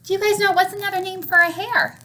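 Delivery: plain reading voice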